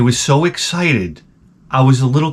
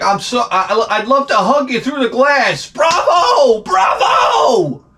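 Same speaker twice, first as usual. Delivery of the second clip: high shouted bravo; cheering the killer's death; overjoyed widower; 10 semitones higher, slower than usual. Pretend. Grieving husband at the execution, shouting high bravos. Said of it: "it's like".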